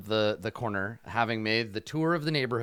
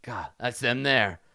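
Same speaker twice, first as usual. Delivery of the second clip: lightly voiced street thug spotting his target; a hint of a rough thug, pointing them out; thug in a light put-on voice. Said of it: "We do the cartoon rewind where we see that same thing".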